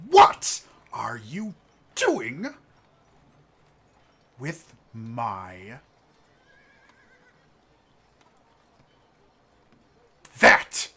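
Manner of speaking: shrill outraged shout, rising to a yell; an affronted noble barking at the thief. Acted.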